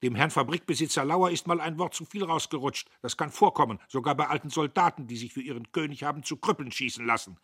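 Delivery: laut und hoch, wütend herausgedonnert; donnernd. A narrator's reading. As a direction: wie ein Offizier auf dem Kasernenhof donnern. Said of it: "Der Major donnerte". (der Major donnert Diederich zornig an)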